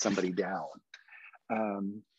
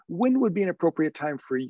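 higher, lilting open question; approachable voice; 4 semitones higher than usual. Shown as a demonstration. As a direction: invite them warmly to set the time. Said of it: "I'm using that approachable voice again".

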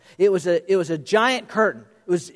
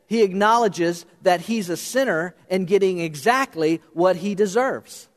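ordinary delivery

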